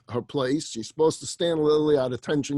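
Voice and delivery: ordinary talk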